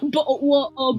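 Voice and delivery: mock British accent. Friend joining the accent mockery, glottal. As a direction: drop the T's like a bad British impression.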